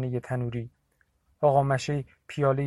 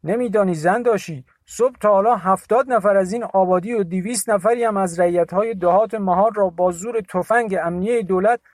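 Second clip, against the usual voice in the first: much higher, louder angry account; Dashi Molla's indignant voice; 6 semitones higher, 7 dB louder than usual. A narrator's reading. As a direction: complain bitterly to Bibi. (صدای مرد خشمگین و بلند)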